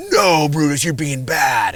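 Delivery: firm, loud scolding of a cub; stern scolding. Retold or imitated. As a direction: tell the cub off sharply. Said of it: "I gave him that tone and that intonation of my voice".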